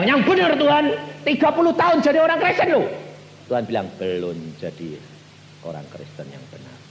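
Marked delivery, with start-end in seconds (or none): 0.0-2.9 high, loud, fast indignant retort; proud protest to God. Retold or imitated. Proud reply to God, retold. 4.0-6.8 divine voice. Retold or imitated. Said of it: "suaranya itu kayak guntur".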